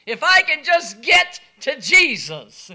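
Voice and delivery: loud, desperate plea; desperate leper. Acted. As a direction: push it out like a dying man's last hope. Leper voiced, desperate hope.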